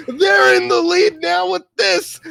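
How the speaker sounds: slow, high hyped announcing; hamming up the big reveal; mock excited announcer. Friend's hyped announcement retold.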